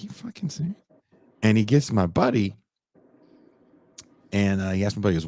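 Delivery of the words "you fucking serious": high, soft incredulous aside. Retold or imitated. Man being frisked by a cop, incredulous under his breath. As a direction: retell disbelief in a high, hushed voice.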